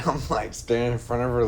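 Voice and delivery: ordinary delivery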